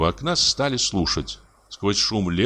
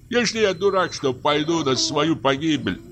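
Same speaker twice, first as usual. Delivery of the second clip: high, plaintive wail; moaning; 8 semitones higher than usual. A narrator's reading. Forester refuses to go to his doom, moaning. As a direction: groan the refusal, pitched high. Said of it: "простонал лесник".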